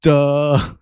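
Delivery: a smug, dismissive duh tossed across the meeting; lightly imitating a coworker's put-down; mocking duh. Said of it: "He was like".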